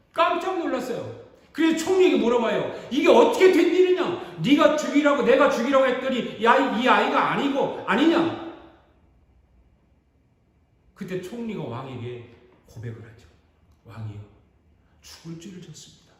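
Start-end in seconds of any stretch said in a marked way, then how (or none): 2.9-8.5 furious king. Acted. 13.8-16.1 trembling confession. Acted. Minister confessing to the king.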